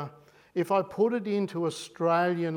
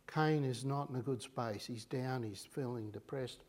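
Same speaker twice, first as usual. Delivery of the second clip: sinking quiet; 4 semitones lower, 7 dB quieter than usual. Acted. Preacher pities Cain, dropping to a murmur. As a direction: voice drops away to a soft low murmur.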